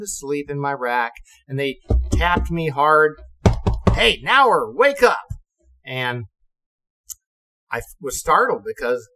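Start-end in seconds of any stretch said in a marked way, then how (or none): 3.9-5.3 higher, louder call to wake up; waking call. Retold or imitated. Officers rousing a sleeping ensign, loud call. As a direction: rouse a sleeping man sharply.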